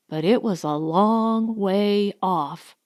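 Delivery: plain reading voice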